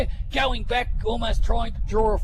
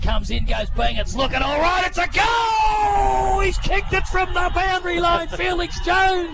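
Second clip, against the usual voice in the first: rising excited shout; long goal yell; 8 semitones higher, 4 dB louder than usual. Real, not acted. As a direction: yell it as the goal sails through. Commentator calls a long goal.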